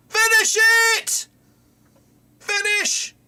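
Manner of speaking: high, loud urging scream; will the striker to score; excited yell. Real, not acted. Fan screaming at striker to finish the chance.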